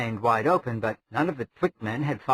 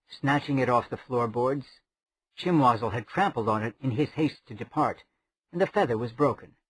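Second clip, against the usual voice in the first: ordinary delivery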